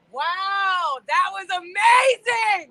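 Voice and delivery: overcome, near sobbing; tearful. Real, not acted. Daughter overwhelmed after seeing her father, tearful.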